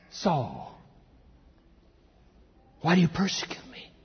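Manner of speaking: soft, grave divine question; hushed voice of the Lord; voice from heaven. Acted. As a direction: ask it softly, with long pauses. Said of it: "he heard a voice say to him".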